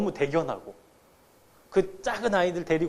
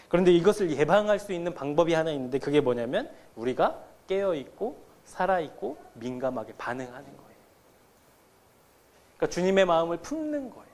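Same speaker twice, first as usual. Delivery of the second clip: ordinary talk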